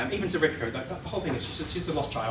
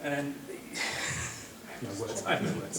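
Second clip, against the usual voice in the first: stunned; 4 dB quieter, much slower than usual. Real, not acted. Stunned by absurd game.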